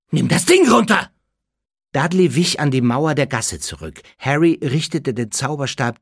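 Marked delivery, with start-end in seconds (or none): 0.1-1.1 high, loud frightened order; startled high cry; alarmed shout. Acted. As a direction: yell it in sudden alarm. Dudley reacts to the pointed wand.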